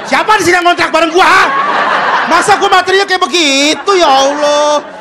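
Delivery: loud angry stand-up bit; angry set. Acted. Comic tries the angry routine he was advised. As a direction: shout it at the crowd. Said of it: "tambahin marah-marah".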